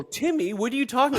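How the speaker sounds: squeaky over-explained sitcom call; TV dialogue line. Retold or imitated. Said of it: "the way they write the shows".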